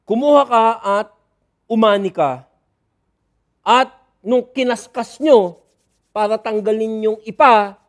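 loud, pointed charge; accusing. Acted. Pharisees charging the disciples with harvesting, loud accusation. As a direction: jab the accusation at them.

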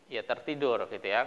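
ordinary talk

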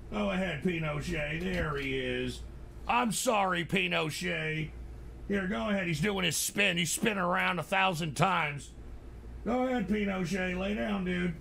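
soft, hushed coaxing; soothing the dog. Real, not acted. Owner calming his dog Pinochet, soft coaxing. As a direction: settle the dog down gently.

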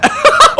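high, loud laugh; burst of laughter. Real, not acted. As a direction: crack up in shock. Sees the worsened graphics.

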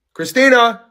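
loud, high call across the room; calling Christina to come over. Real, not acted. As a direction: shout a name to get her attention.

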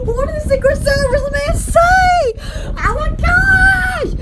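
very high, gushing excitement; starstruck squeal. Pretend. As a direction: squeal it like a thrilled fan. Reacting to imagined Secret Service remark.

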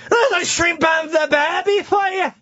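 hammed-up Australian accent, high, slow and shouted; bad Aussie accent. Joking about accents, he yells a cartoon Australian accent. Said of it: "I don't do accents, but this one's good".

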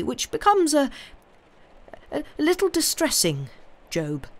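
put-on haunted old gentleman searching for a mild word; kindly gentleman, troubled. Gentleman confides to Job that he is watched, troubled. Voices Mister Jarvie confessing he's watched.

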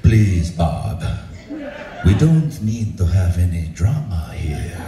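low, slow ASMR-like soothing; imitating an unhurried, ultra-calm director. Director calms the furious Bob down, very calm. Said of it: "he was so calm".

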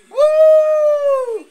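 loud high cheering whoop. Real, not acted. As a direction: let out a big excited woo. Cheering for pizza.

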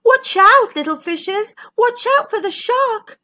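high-pitched storybook warning; urgent warning. Acted. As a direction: warn the little ones of danger. Warns the little fishes.

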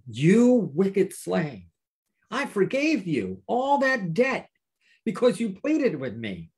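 drawn-out, stern rebuke; angry lord. Acted. Lord rebukes the slave he forgave, stern and indignant. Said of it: "now he's angry".

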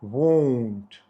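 "Won't" with a round, drawn-out o, low; won't with a long o. Shown as a demonstration. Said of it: "то додають звук «у»".

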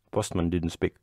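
ordinary delivery, nothing marked

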